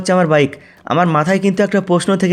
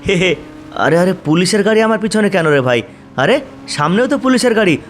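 usual speaking voice

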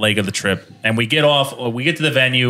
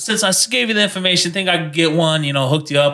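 imitated New York local, pitched up then easing down; New York accent; 5 semitones higher than usual. Italian local bumming a cigarette, put-on New York accent. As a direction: ask a favor in mock New York accent. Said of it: "this Italian guy".

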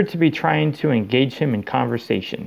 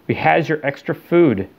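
lightly squeaky elf; 4 semitones higher than usual. A narrator's reading. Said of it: "squeaked an elf".

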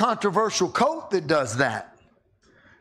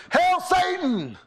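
mock heckler shout; 11 semitones higher, slower than usual. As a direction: bellow it across the cabin to mock. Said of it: "One guy says".

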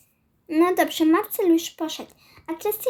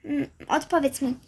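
ordinary talk

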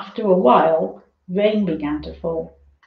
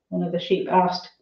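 ordinary delivery, nothing marked

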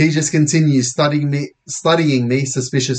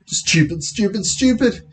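rising, higher-pitched self-reproach; self-scolding; 8 semitones higher than usual. Acted. Boy realising his mistake, scolding himself. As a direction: kick yourself with each repeat.

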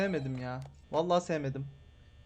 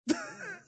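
nervous high laugh. Real, not acted. After the bear scare.

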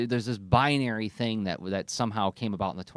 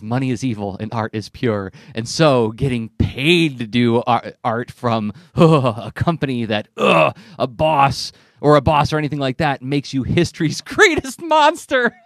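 loud, slow, hammed-up preachy proclamation, breaking into laughter; sarcastically overstate the quote as gospel; mock outrage; 9 dB louder than usual. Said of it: "comic where it says".

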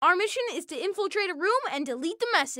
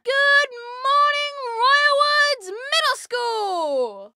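announcer; 4 semitones higher, 4 dB louder, much slower than usual. Acted. School announcement greeting.

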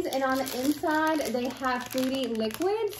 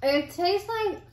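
talking around chewy candy; speaking while chewing gummy; mouth full chewing; much slower than usual. Real, not acted. Seen 0: Right speaker chews bitten gummy, cheeks bulge while speaking.

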